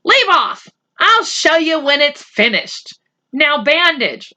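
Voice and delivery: put-on high, sharp protest as a boy; snapping at his friend to back off; annoyed boy. Boy fending off a friend grabbing his notebook, high and sharp. Adil snatching at Omri's notebook.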